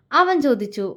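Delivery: ordinary delivery, nothing marked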